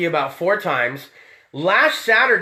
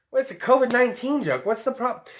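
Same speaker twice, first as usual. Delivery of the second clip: normal talking voice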